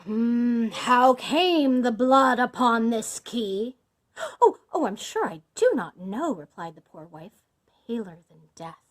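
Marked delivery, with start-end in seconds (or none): 0.0-3.8 loud, slow accusing question; booming, deliberate suspicion; menacing interrogation. A narrator's reading. Bluebeard confronts his wife. 4.4-6.3 fearful denial. A narrator's reading. Terrified wife denies it.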